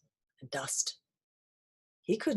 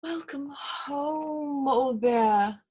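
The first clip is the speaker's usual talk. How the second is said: warm welcome; 7 semitones higher, much slower than usual. Acted. Greeting returning friend.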